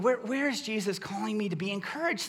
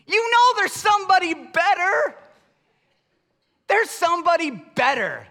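emphatic rebuttal; 8 semitones higher, 8 dB louder than usual. Real, not acted. Rebutting the parent chant.

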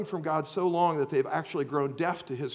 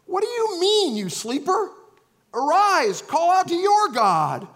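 high, loud and urgent, a captain rousing a sleeper; alarmed shout; 11 semitones higher, 8 dB louder than usual. Acted. Captain to sleeping Jonah in a storm, loud alarmed shout. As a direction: shake him awake with a shout.

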